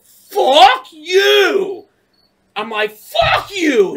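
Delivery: furious drawn-out high yell; full-volume re-enacted rage; mad. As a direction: scream it back at the passenger. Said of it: "I was so mad, dude".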